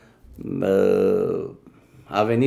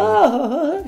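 high triumphant ah-ah-ah; gleeful cry; slower than usual. Retold or imitated. Waiter crows after winning over the ambassador. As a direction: crow over the win, pitched way up.

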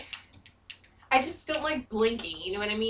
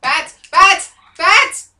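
higher and louder warning yells; alarmed shouting; 6 semitones higher, 13 dB louder, much slower than usual. Real, not acted. Gamer warning partner about bats, shouting.

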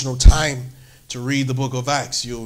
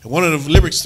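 normal talking voice

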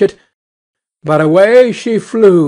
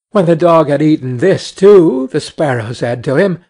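ordinary delivery, nothing marked

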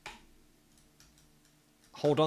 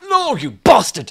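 loud, high shout of frustration; furious outburst; 5 semitones higher, 11 dB louder than usual. Real, not acted. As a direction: curse the fall at full voice. Climber falls.

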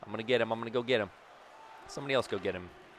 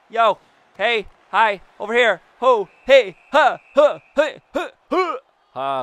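short, high, raised calls; calling for attention; 7 semitones higher, 8 dB louder than usual. Real, not acted. Player calling teammates for the puck, urgent short shouts. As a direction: pelt out quick loud calls one after another.

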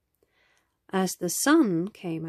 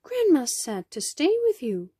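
put-on higher-pitched child character; lightly voiced girl, pitched up to explain; little girl explaining; 6 semitones higher, faster than usual. A narrator's reading.

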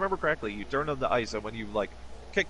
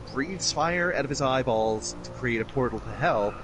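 normal talking voice

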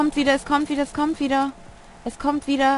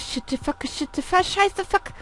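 panicked swearing; 8 semitones higher than usual. Real, not acted. Swearing after monster scare.

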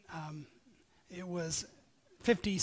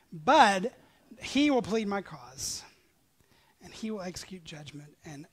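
normal talking voice

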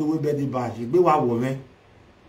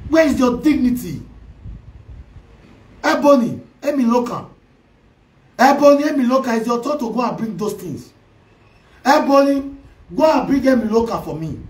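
high, loud calls of "Ebony"; loud scolding; 5 semitones higher, 4 dB louder than usual. Real, not acted. Vlogger loudly calling out Ebony, scolding. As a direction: call her out, demanding.

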